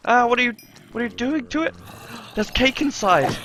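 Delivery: high, fast frantic cries; frantic panic. Acted. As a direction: panic as it's torn open. Zombies break into the present.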